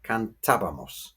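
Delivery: cantábamos stressed as can-TA-ba-mos; cantábamos with TA stressed, last two syllables light; stress on accented syllable. Shown as a demonstration. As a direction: put the weight on TA in cantábamos. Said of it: "with the accent".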